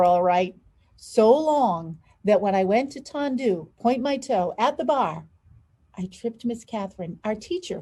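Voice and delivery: lively storybook read, big pitch swings; animated picture book narration; reading to children. A narrator's reading. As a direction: read aloud to kids with expression. Reads picture book aloud.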